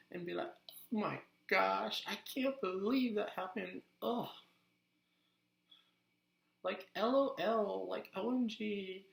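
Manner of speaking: put-on valley girl. Shown as a demonstration. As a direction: gush and complain like a valley girl. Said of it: "easily go into the voice".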